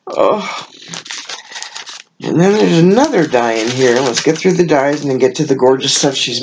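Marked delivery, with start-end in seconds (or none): none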